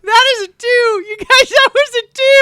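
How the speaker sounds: high, loud, laughing out the words; can't believe the roll, cracking up; laughing disbelief. Real, not acted.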